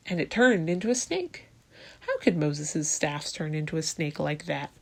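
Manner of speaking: usual speaking voice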